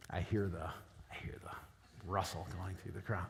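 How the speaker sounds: hushed stage whisper, slowed down. Pretend. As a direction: breathe it like a secret aside. Joking aside about audience murmurs.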